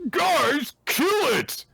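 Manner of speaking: high, hammed-up command; lightly voiced frantic bully; mock order. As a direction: yell it like a panicked bully.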